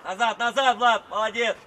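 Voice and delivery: loud repeated command, then praise; coaching shout. Real, not acted. Coach sending Vlad back, loud shout. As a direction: shout the player back across the pitch.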